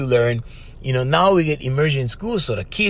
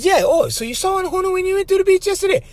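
much higher, fast, hammed-up parroting question; mock squeaky echo. Radio partner parroting the professor, squeaky mock question. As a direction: squeak the whole line back as a silly question. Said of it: "He said".

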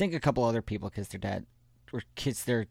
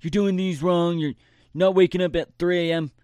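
higher, louder preachy scold; mock motivational guru; 5 semitones higher, 3 dB louder than usual. Mocking a motivational guy who nags about waking at three, preachy scold. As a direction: parody a pushy guru lecturing slackers.

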